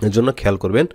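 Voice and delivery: usual speaking voice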